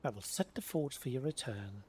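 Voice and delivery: normal talking voice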